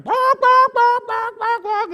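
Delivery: high, loud imitated goose honks; goose honking. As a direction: honk like an angry flock of geese. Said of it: "and it's just like".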